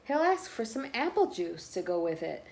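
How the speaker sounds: lively picture-book read-aloud voice; reading to children. A narrator's reading. Reading a picture book to listening children, bouncy and lively. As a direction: read the story to the little ones.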